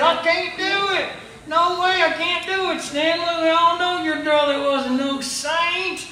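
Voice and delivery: high, loud indignant refusal; outraged refusal. Retold or imitated. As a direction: refuse fiercely, scandalized. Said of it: "he said".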